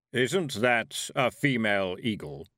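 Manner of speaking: puzzled. A narrator's reading. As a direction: wonder aloud, slow and heavy. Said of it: "Death said in a puzzled voice".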